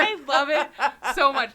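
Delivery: giggling through the words; laughing delightedly while talking. Real, not acted. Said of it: "You're doing it again".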